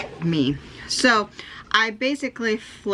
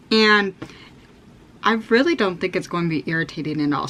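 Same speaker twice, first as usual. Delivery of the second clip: usual speaking voice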